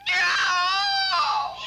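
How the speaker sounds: anguished cry of no. Acted. Locked-in daughter cries out in despair. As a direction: wail it out.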